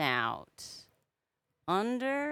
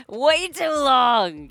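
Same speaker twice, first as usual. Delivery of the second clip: laughing; 7 semitones higher, 15 dB louder, much slower than usual. Real, not acted. Laughing repeat.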